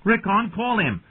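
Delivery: high, urgent plea; high-pitched, pressing call; boy's shout. A narrator's reading. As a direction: shout an order to your little brother. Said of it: "Bran shouted to his baby brother".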